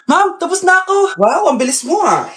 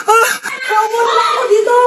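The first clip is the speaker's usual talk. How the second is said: scared cry; 6 semitones higher than usual. Acted. Scared by ghost in car.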